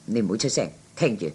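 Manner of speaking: low, soft hush to a friend; hushed aside. Acted. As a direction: keep it down so the next table won't hear. Hushing companion to listen in.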